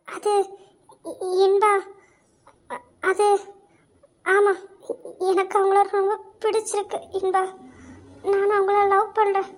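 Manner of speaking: quiet, halting love confession; hesitant admission. Acted. Friend admitting love to Inba, hesitant and quiet. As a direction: ease it out between long pauses.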